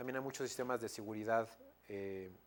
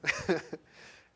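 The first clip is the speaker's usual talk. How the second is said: loud, high chuckle; amused burst of laughter; real laugh; 6 semitones higher, 9 dB louder than usual. Real, not acted. Laughs after dropping the translation question.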